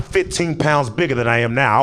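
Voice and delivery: usual speaking voice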